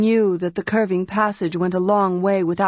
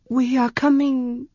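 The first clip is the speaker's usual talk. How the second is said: voice failing; 6 semitones higher, slower than usual. Acted. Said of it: "her voice failed her".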